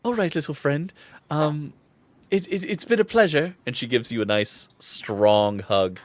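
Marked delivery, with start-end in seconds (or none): 0.0-3.5 higher, gentle character voice; daughter's fond farewell. Acted. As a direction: say a halting goodbye to a little friend. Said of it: "She's like".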